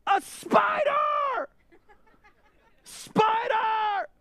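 put-on high, drawn-out child's shriek; little girl screaming. Daughter Glory in the back seat, shrieking high and slow. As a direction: scream it like a terrified small girl.

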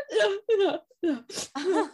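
high, laughing-crying "I'm okay"; mock sobbing reassurance. Telling the conductor she's okay while crying. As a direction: pretend you're fine through tears.

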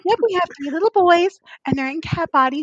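ditzy mimicry with mewing at the end; mocking Amy. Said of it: "she did not just say".

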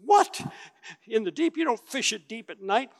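a sharp, high "What?" then doubting protest; can't believe the order; incredulous. Acted. Disciples doubting Jesus' fishing advice, incredulous.

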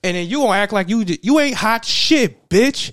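loud, high, heated call-out; angry yell. Retold or imitated. Dennis stepping in and shouting down the football player. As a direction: tell him off, ready to fight.

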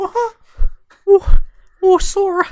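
very high, loud strained squeak; bad high cartoon mouse attempt; Mickey Mouse voice. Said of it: "I can't do a Mickey Mouse voice".

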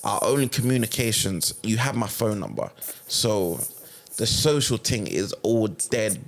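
normal talking voice

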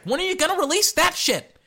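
high, loud exasperated demand; exasperated rant. Real, not acted. Host demanding the NFL release its list, exasperated yell. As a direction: yell your frustration at the league.

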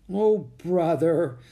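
slow, high, loud exclamation falling away; nervous. A narrator's reading. Jack embarrassed by his sister before pilgrims, nervous. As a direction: groan it out uneasily. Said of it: "with a nervous laugh".

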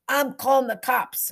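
high, loud neighbour's yell; angry threat. A narrator's reading. An angry neighbour before slamming the window, yelling. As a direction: holler the threat out angrily.

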